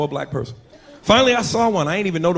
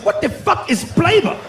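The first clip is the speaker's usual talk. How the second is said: baffled; 10 semitones higher, slower than usual. Retold or imitated. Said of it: "I said".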